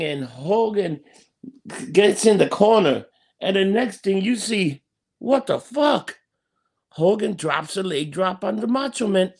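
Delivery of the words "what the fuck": shocked. Real, not acted. Shock at Hogan's betrayal.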